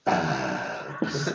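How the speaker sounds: deep, low trailer-guy delivery of Abs; Honest Trailer voice. Retold or imitated. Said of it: "as the Honest Trailer guys would say".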